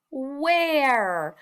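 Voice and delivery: "where" stretched out as one smooth word; slowly blended word. Shown as a demonstration. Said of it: "say the word slowly".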